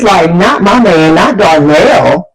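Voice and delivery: slow, drawn-out denial; dragged out, refusing to believe it; disbelieving. Retold or imitated. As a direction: stretch each word in mock disbelief.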